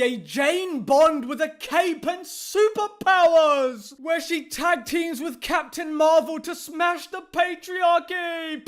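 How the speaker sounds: high-pitched, over-the-top sarcastic trailer build; mock hype. Critic imagining a superpowered Jane Bond film, mock hype. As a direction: hype the absurd plot with scorn.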